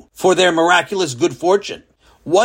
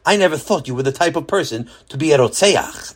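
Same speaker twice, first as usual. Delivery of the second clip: plain reading voice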